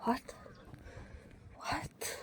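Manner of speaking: usual speaking voice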